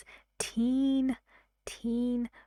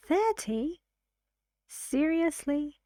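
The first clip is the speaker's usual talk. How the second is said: high, rising disbelief; puzzled questioning; 6 semitones higher than usual. Acted. Listener asks to make sure they understood, puzzled and questioning. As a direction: act the listener double-checking what was heard. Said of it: "the listener asks a question to make sure".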